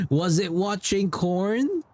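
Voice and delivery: high, slow, drawn-out mock question; playful high-pitched ribbing; teasing repeat. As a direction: stretch it out to tease the chat.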